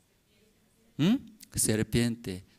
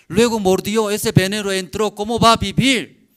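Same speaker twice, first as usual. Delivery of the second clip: much higher and louder, shouting the promise; full-force preaching; 8 semitones higher, 11 dB louder than usual. Acted. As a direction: cry it out like a revival preacher. (predicación a todo pulmón, gritando la promesa)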